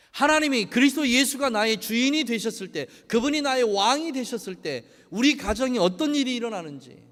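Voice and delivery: loud, high, impassioned sermon delivery; rising pulpit fervour; full-force preaching. Acted. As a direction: preach it out to the congregation. Preaching to congregation.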